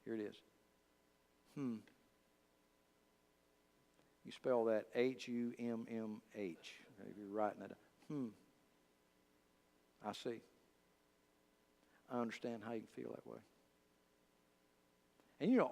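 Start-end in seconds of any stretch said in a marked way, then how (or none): 1.6-1.8 soft, thoughtful counselor's hum; brief, understated hum of acknowledgement; listening counselor. Shown as a demonstration. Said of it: "wise counsel has been this". 10.1-13.4 respond quietly, as a patient listener would; understated counselor's sympathy; listening counselor. Shown as a demonstration. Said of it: "wise counsel has been this".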